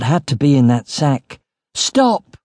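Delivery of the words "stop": high-pitched urgent shout. A narrator's reading. Narrator lunges to stop Yuri opening the sack, shouting. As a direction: cry it out high to halt him. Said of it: "I shouted".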